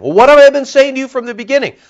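high, loud, fast rebuke; calling to account. Retold or imitated. Jesus calling the leaders to account, high and loud. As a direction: demand it, holding them to account. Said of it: "he's calling them to accountability".

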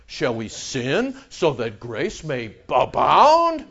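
slow, soft put-on objector's voice; mock questioning people. People question Paul about free grace, slow mock asking. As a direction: draw it out as a sly question. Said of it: "and the people would go".

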